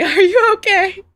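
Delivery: high, loud mock-worried mother; high, loud, worried mother's voice; mother's worried question. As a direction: squeak it like a fretting mother. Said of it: "my mother asked me".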